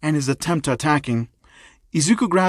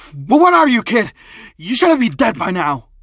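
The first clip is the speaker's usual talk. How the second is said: loud, high-pitched alarm; stuttering shock; 7 semitones higher, 5 dB louder than usual. Acted. First thug sees the kid still alive, shocked. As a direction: blurt it out, stumbling in disbelief. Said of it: "would be stuttering".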